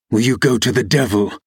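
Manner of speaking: understated ferocity; a touch of anger, not shouted; ferocious exclamation. A narrator's reading. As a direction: tell her off with mild fierceness. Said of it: "he exclaimed ferociously".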